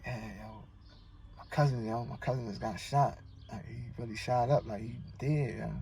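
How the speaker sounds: quiet and hushed, barely carrying; low undertone. Retold or imitated. Cousin on the phone breaking the bad news, quiet undertone. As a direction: keep it low and shaken, almost under the breath. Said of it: "your tone low".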